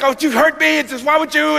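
high, whiny hurt wife; imitating a hurt wife; mock wounded wife. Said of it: "she's just like".

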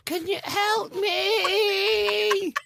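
very high, very slow crying plea; high pleading wail. Pretend. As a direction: wail it like a helpless child. Voicing the baby Robin.

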